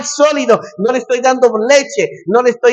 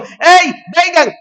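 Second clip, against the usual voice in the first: calling out; 8 semitones higher, much slower than usual. Retold or imitated. Pastor calling his congregation.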